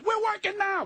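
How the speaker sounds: high mocking retort, a touch incredulous. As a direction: toss the line back at him, mocking. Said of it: "he goes".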